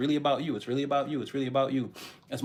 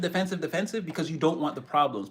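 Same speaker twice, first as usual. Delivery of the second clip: ordinary delivery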